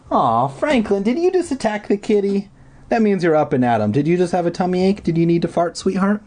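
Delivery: high, fast cooing pet talk. Real, not acted. Reader cooing at pet Franklin in baby talk. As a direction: coo at the cat.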